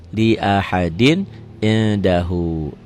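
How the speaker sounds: drawn-out, chanted Arabic reading; measured tajwid recitation; slow Quran recitation. Shown as a demonstration. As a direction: recite it slowly, stretching each vowel. Teacher recites Quranic phrase.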